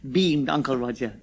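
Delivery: ordinary delivery, nothing marked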